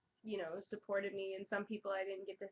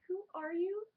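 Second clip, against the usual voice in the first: puzzled; 10 semitones higher, 4 dB louder than usual. Retold or imitated. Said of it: "I'm like".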